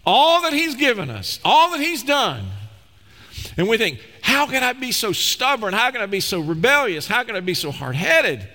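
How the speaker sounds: high, exclaiming pulpit voice; impassioned preaching. Acted. Preacher marvelling at grace and human stubbornness, impassioned. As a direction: cry out in wonder, then self-reproach.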